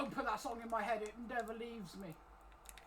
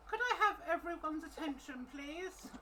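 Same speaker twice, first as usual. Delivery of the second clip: put-on upper-class English accent, pitched higher; posh English accent; 6 semitones higher than usual. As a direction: clear the throat and ask like a posh noble. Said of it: "That was a bit posh".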